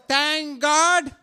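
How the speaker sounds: normal talking voice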